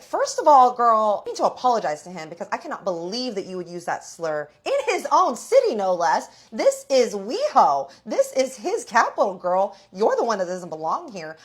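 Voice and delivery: loud, emphatic retold rant; indignant telling-off. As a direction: scold her, punching the key words hard. Retold telling-off over a slur.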